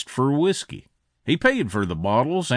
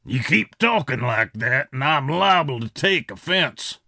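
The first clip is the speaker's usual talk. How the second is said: lightly voiced threatening villain; hint of a raspy Western bad man; raspy outlaw. A narrator's reading. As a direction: warn him in a slightly rough character voice. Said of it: "in that raspy voice of his".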